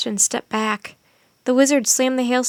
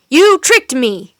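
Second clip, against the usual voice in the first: louder still and dragged out slowly; slower furious cry; 3 semitones higher, 11 dB louder, slower than usual. A narrator's reading. Wizard repeats his cry, slower and more furious. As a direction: lean hard on every word of blame. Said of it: "cried the wizard".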